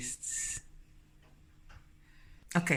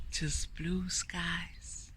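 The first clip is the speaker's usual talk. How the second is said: very slow, soft whisper; soft, contented; 3 semitones higher, 8 dB quieter, much slower than usual. Real, not acted. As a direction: savour the blue sky. Admiring the weather.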